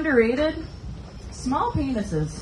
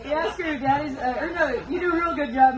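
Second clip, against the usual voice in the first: usual speaking voice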